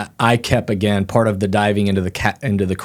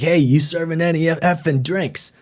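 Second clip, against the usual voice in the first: put-on higher, brash Northeastern US accent; mock Northeastern bar regular, pitched up; 8 semitones higher than usual. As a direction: imitate a pushy Northeastern guy at the bar. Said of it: "He's got an accent and everything".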